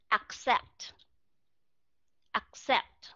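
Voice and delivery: Accept, both k and s sounded; model pronunciation. Shown as a demonstration. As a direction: Accept with ak-SEPT, k then s. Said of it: "không thể biến cái đó là accept".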